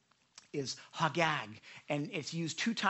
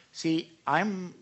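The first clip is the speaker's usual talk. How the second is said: normal talking voice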